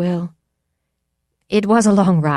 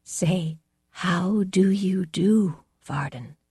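tremulous whisper; 4 semitones higher, much slower than usual. A narrator's reading. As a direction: whisper it with a tremble. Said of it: "Derridin's tremulous whisper".

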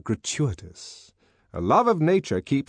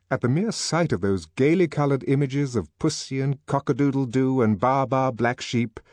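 plain reading voice

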